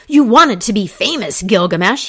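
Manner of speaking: loud, high battle cry. Acted. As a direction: shout it over the struggling bull. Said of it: "he cried".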